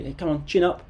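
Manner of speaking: upbeat, cheery encouragement; encouraging friend. Acted. Patting a glum friend on the back, upbeat encouragement. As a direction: gee a sad mate up brightly. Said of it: "pat your friend on the back and say".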